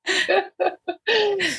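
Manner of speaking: bright high burst of laughter. Real, not acted. Laughing at own joke.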